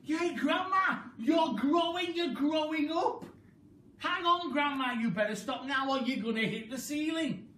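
put-on high, loud boyish yell; excited shout. A narrator's reading. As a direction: jump up and shout in amazement. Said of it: "George gave a jump and shouted".

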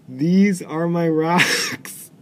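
very slow, drawn-out deadpan reading; slow deadpan quote. Retold or imitated. Player reading out Mard's odd line, slow deadpan. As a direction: savour every word solemnly. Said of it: "what she says is".

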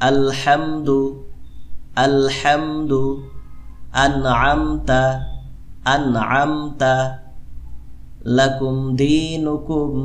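slow, measured Quran recitation for students; teacher recites for students to repeat back. Shown as a demonstration. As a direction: recite slowly so students can follow. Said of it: "আমার সাথে আরেকবার পড়ুন".

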